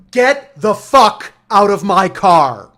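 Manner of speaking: slow, high furious shout, each word punched; loud, mad. Retold or imitated. Driver throws out rude passengers, loud and mad. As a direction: bellow it slowly as an eviction order. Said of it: "loudly, lo-, bass voice, mad".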